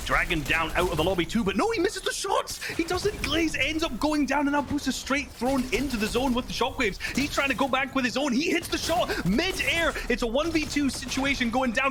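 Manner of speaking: high, excited victory call; esports caster. Acted. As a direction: scream the final play home. Calls the winning final moments.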